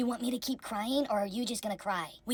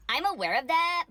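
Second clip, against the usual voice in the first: pitched up, sassy comeback; 8 semitones higher, 4 dB louder than usual. Acted. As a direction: toss it off with attitude. Mock AOC snapping back.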